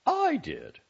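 high, fretting mother voice; fussy mother voice. Acted. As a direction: nag like a worried mother. Mother answers sharply.

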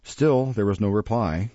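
usual speaking voice

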